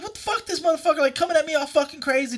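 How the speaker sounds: retold agitated griping; worked-up complaint. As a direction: vent to your friend in a rush. Retold heated complaint to friend.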